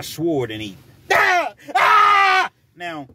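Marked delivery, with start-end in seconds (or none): 1.7-2.5 mock scream. Demoing the victim's scream.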